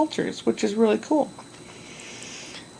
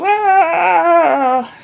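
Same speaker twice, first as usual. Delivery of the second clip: sudden high, loud mock yell "Ah!"; book character yelling; 8 semitones higher, 5 dB louder than usual. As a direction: imitate the yelling guy from the book with a sharp cry. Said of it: "one page this guy's yelling".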